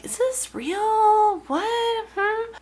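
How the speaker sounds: high, drawn-out mock puzzlement; hammed-up high-voiced confusion; puzzled wondering. Acts out a puzzled email recipient.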